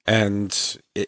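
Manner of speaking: usual speaking voice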